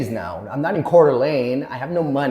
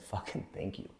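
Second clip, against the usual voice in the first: whispered, much softer thanks; relieved whisper; 4 semitones lower, 19 dB quieter than usual. Retold or imitated. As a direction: breathe the thanks out low. Relieved reply to the jail staff.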